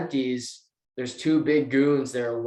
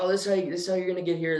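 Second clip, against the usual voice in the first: quick, higher, rattled-off directions; fast directions; 4 semitones higher, much faster than usual. Retold or imitated. Bartender hurrying directions to Marius, fast and higher. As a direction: rush the directions out.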